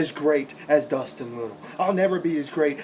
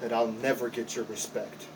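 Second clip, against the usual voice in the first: quiet bitterness; 4 semitones lower, 7 dB quieter than usual. Acted. Wrestler admitting he'll never earn trainer's respect, low and soft. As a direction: let the voice sink in defeat.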